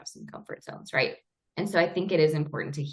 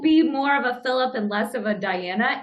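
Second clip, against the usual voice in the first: teasing mock advice; 5 semitones higher, 3 dB louder than usual. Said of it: "if I said".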